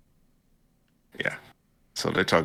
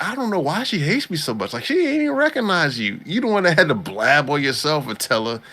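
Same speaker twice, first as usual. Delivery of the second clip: mock her whiny complaint, pitched way up; imitating her; 9 semitones higher than usual. Mocking the girl who blabbed, high and mocking. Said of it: "she was like".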